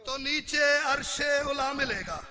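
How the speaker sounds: measured verse recitation, each line falling; poem. Real, not acted. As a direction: recite couplets to the gathering. (ठहर-ठहर कर शेर पढ़ना)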